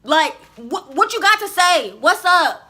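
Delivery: loud, high, drawn-out comeback; impatient retort. Retold or imitated. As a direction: re-enact being fed up, punch each word. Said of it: "I was ready to hear it".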